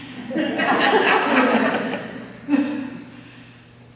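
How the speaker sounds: loud, breathy rising laughter; real laugh. Real, not acted. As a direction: laugh with delight. Group realizes the point.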